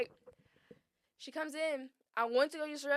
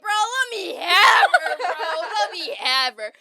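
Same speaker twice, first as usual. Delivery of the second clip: laughing plea; 5 semitones higher, 13 dB louder, slower than usual. Retold or imitated. Crying while brother pulls her off.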